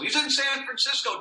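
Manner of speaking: high, sustained call relayed to the room; joking background relatives. Retold or imitated. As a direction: imitate a relative calling the news across the room. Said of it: "they were always joking and in the background".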